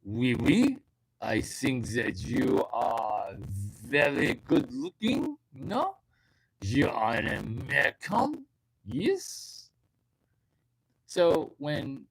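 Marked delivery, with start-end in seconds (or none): 0.0-9.7 slow, flirty French dude with rising question ends; French accent, lilting up sharply on the questions. Acted. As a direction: do a seductive Frenchman's English, drawn out. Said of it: "Have you met a French dude?".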